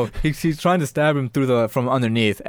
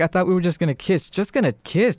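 higher-pitched, incredulous taunt; mocking disbelief; 6 semitones higher than usual. Girl taunting Junior mid make-out, mocking disbelief. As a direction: act out her scornful questioning.

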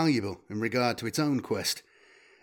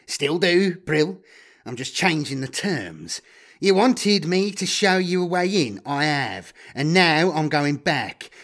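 higher-pitched, taunting character voice; smug, raised voice of a betrayer; cocky double-crossing guide; 4 semitones higher, 3 dB louder than usual. Acted. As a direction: gloat over changing the deal. Voices Magritte's dialogue.

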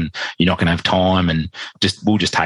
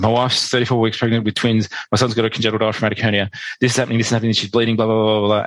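higher, rushed gabble; panicked blurting; 3 semitones higher, faster than usual. Retold or imitated. Husband phoning the birthing unit, panicked blurting. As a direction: reel it off breathlessly fast, imitating panic. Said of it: "I just blurted out".

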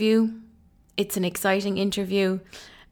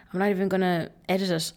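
ordinary talk

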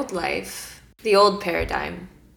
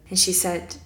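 ordinary talk, nothing marked

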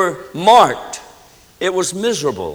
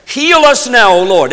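loud, high call leading a congregation in prayer; praying aloud; 6 semitones higher, 6 dB louder than usual. Real, not acted. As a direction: cry the prayer out to God. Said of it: "pray that prayer".

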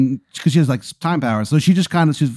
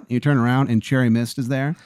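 ordinary delivery

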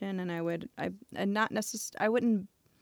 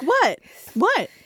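surprised; 10 semitones higher, 10 dB louder than usual. Retold or imitated. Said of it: "And they're like".

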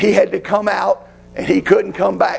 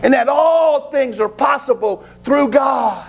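rising preaching shout; 6 semitones higher than usual. Acted. Preacher builds prayer to a shout.

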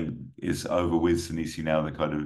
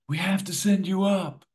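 high, raised, urgent plea; urgent official; 4 dB louder than usual. Acted. Official briefing Poe under chopper blades, high urgent voice. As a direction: push it up high, as if over helicopter blades.

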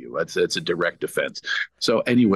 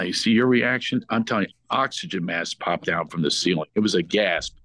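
usual speaking voice

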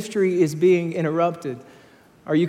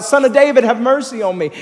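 yelled plea; 7 semitones higher, 6 dB louder than usual. Acted. Blind man yells out.

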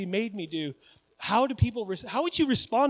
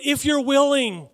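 raised, louder plea; begging; 6 semitones higher, 6 dB louder, slower than usual. Retold or imitated. Leper begs Jesus to be healed, raised pleading. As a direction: quote it as an earnest appeal. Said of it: "He begged to be healed".

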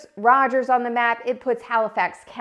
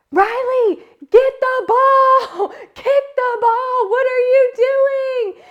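much higher and louder, slow drawn-out yelling; retold sideline yell; 7 dB louder, slower than usual. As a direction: yell it at the kid across the field. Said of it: "I am yelling on the sidelines".

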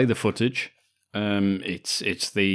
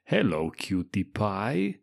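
put-on Austrian accent; slower than usual. Repeating movie line in character.

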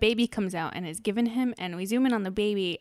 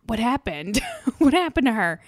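retold alarm; 3 semitones higher, 7 dB louder than usual. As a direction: relive the shock, breaking into a laugh. Retelling an alarmed reaction to scene.

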